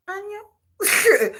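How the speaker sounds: high mock hello on the phone; high girlish answer. Woman answering again.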